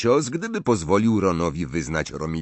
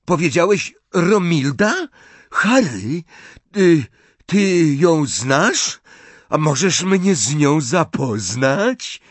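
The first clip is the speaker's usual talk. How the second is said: high, halting, awestruck pleading; wild hope; 7 semitones higher, 5 dB louder, slower than usual. A narrator's reading. Lovestruck boy begging for an introduction, wildly hopeful. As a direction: draw it out high with eager hope. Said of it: "twarz rozkwitła szaleńczą nadzieją".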